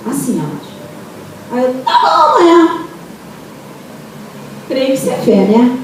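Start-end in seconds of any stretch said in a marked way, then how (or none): 1.8-2.8 very high, excited acted reply; eager agreement. Agreeing to tomorrow's cleaning on the phone, high eager reply. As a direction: agree brightly at the top of your range.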